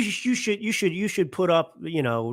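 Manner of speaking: ordinary talk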